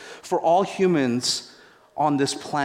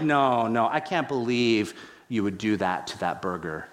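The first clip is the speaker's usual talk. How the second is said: low-pitched mock reproach; mock dismay; 6 semitones lower than usual. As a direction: scold with pretend sorrow, pitched down. Deadpan mock dismay over the burger.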